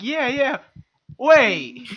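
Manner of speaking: dawning confusion. Retold or imitated. Managers catching the boss's mistake, sudden double take. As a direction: agree brightly, then a loud, high double take. Managers retold reacting to misspelling.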